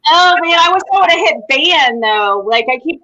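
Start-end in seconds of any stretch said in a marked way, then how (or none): none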